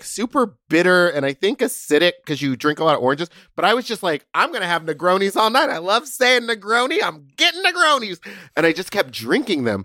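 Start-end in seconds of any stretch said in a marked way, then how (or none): 4.3-8.2 rising giddy excitement, higher and higher; building giddy, pitch climbing; excited. Retold or imitated. Retold own tipsy excitement.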